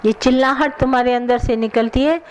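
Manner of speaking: ordinary talk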